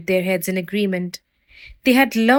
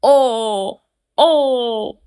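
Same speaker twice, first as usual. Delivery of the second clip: loud, higher howls of pain; howling wolf; 5 semitones higher, 5 dB louder than usual. Acted. Wolf howling in pain outside the brick door. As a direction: howl out as if hurt. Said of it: "growling and howling with pain".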